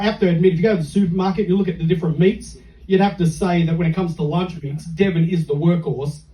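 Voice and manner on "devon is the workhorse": usual speaking voice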